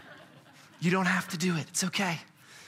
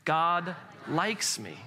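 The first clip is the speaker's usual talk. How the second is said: slow, drawn-out words for a group; measured group recitation, word by word; leading unison recitation; much slower than usual. Shown as a demonstration. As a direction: pace it slowly so everyone can join in. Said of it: "Say it with me".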